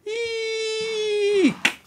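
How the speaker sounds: very high, drawn-out eek; shriek. A narrator's reading. Emily with cockroach on back, panicked shriek. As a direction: squeal thinly in panic.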